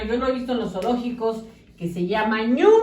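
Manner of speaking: usual speaking voice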